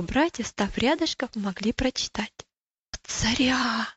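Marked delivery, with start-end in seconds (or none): 2.9-3.9 put-on hushed, airy whisper of a young boy. A narrator's reading. Little brother reading the king's letter, whispering. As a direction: whisper it in wonder. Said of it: "прошептал Иосиф".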